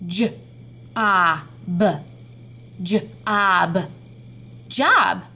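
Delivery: job sounded J, A, B, then job; teacher modelling sounds of job; sounding out. Shown as a demonstration. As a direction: segment and blend job. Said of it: "Let's do the sounds of this word".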